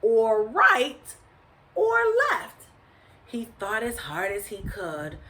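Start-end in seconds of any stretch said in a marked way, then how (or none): 0.0-2.7 puzzled, rising weighing of options; pondering. A narrator's reading. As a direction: waver aloud between the two choices. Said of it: "he pondered".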